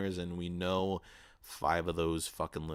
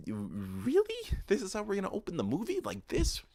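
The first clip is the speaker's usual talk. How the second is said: high-pitched incredulous protest; 13 semitones higher than usual. Retold or imitated. Viewer balking at the movie's opening sex scene, incredulous. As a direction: question it in high-pitched disbelief. Acting out his disbelief at opening.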